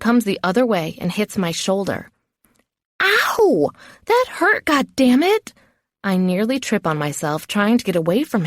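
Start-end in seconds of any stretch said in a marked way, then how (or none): 3.0-5.4 high-pitched yelp of pain and protest; pained outburst. Acted. Trainee hit on the shoulder, high pained outburst. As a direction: cry out high at the blow.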